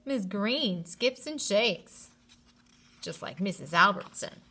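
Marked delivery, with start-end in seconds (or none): none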